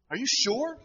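high imitated wife, still unconvinced; acted nagging doubt; disbelieving question.

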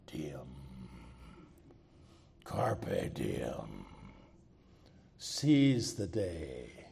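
deep, slow, raspy whisper; low and slow, like a secret from the dead. Retold or imitated. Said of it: "in a low, raspy voice".

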